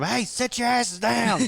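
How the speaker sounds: high, loud, drawn-out bus-ride scolding; yelling. Acted. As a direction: yell it like a coach at rowdy players. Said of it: "yelling at him".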